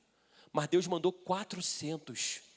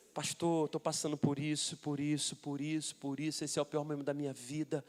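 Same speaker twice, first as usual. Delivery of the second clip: mock lament; 3 semitones lower than usual. Lady unloading her troubles on the pastor, mock lament. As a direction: list troubles in a subdued murmur.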